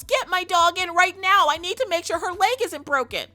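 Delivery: high, loud hysterical insistence; hammed-up panicked order; mock frantic demand. Owner demands the vet see her dog, mock frantic.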